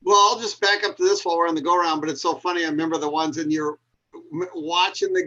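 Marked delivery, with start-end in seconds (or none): none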